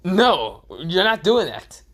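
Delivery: loud, high-pitched flat refusal; Konohamaru. Acted. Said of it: "And Konohamaru goes".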